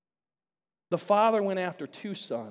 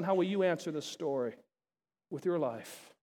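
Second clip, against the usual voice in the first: softer, sinking into an intimate hush; quiet personal question; 3 semitones lower, 8 dB quieter than usual. Real, not acted. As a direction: lay it gently on each listener. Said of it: "So I throw that out to you".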